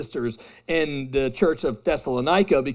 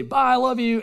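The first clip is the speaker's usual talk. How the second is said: flippant goodbye; 7 semitones higher than usual. Retold or imitated. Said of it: "So I'll say".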